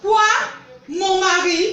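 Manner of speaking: high, loud incredulous question; loud, rising outburst of disbelief; outraged disbelief. Acted. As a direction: throw it back as a shocked, rising question. Suspects sister wants her husband.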